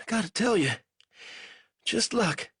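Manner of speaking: a weak captive fumbling for words; lightly voiced delirious prisoner; feverish prisoner. A narrator's reading. Banks voice.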